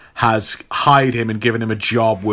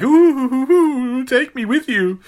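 squealing high breathless ooh, much higher; mock swoon, falsetto delight; hammed-up femme fatale cooing, high; swooning Natasha. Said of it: "Madame Natasha is like".

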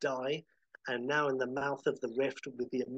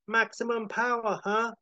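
high, raised heroic shouting; defiant shout; 9 semitones higher, 5 dB louder than usual. Acted. Howard shouts at Chet on the cusp of the rift, defiant. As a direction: shout back at the villain. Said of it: "And Howard shouts".

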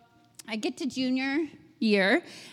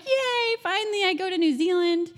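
put-on high, fast girlish yay; squealing with joy; excited squeal; 7 semitones higher than usual. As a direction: burst out with glee. Excited about graduation trip.

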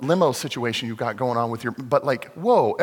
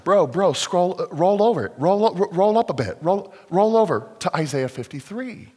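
louder casual urging, bro; eager bro voice; 5 semitones higher, 7 dB louder than usual. Acted. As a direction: hurry a friend along. Eunuch urging Philip to roll scroll.

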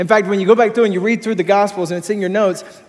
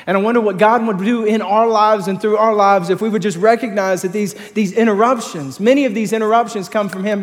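louder, higher preaching push; preaching at full force; 5 semitones higher, 4 dB louder than usual. Real, not acted. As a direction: press the challenge on the church. Preaching builds again.

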